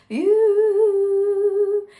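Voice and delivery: high, slow, fluffy falsetto; light falsetto. Shown as a demonstration. Coach showing the in-between falsetto. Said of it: "It's kind of falsetto-y".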